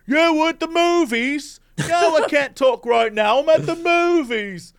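high put-on voice; mock phone call voice; rude moviegoer on the phone. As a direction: mimic someone taking a call in the cinema. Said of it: "they're like".

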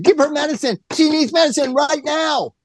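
mother pleading with nurses for her sick daughter, frantic and high; imitating Shirley MacLaine. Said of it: "Shirley MacLaine goes to the nurse's desk and said".

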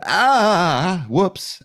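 high, drawn-out mock "ah, whoops"; retold doctor's whoops. Patient mimics doctor calling back to correct herself, mock whoops. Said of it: "she called me an hour later and said".

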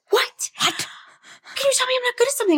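put-on high, fast, indignant little-girl voice; high squeaky disbelief; shocked child. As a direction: play the stunned daughter. Daughter outraged, retold.

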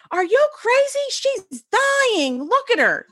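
very high-pitched mock shriek; imitated screaming. Best friend screams at husband before the kids, mock shriek. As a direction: play a hysterical friend, voice way up. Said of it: "my best friend starts screaming".

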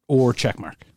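ordinary talk, nothing marked

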